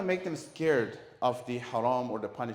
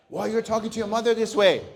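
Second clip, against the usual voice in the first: put-on loud, sharp scolding of a child; angry parent scolding; 8 semitones higher, 12 dB louder than usual.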